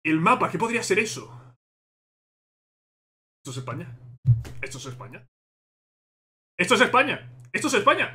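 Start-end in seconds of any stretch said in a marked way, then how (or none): 3.4-5.3 much softer, lower, almost to himself; hushed disbelief. Real, not acted. Fan asking himself if it is Spain, hushed. Quiet disbelief before shouting. 6.6-8.1 triumphant cry. Real, not acted. Fan confirming to viewers it is Spain, triumphant cry. Answers himself loudly.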